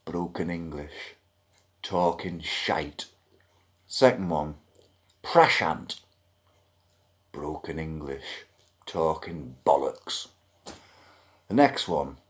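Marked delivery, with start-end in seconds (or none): 0.1-3.1 deadpan. Real, not acted. Deadpan verdicts down a list. 7.3-10.3 low, soft, weary dismissal; deadpan verdict. Real, not acted. Customer rattling off complaints about live-chat agents, deadpan.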